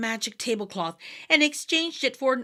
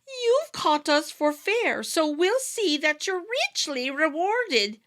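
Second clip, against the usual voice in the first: slow, higher wee-folk voice holding back a laugh; slow high fairy voice, amused; sly little man; 6 semitones higher, slower than usual. A narrator's reading. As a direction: tease him gently while hiding a smile. Fairy leader, trying not to laugh.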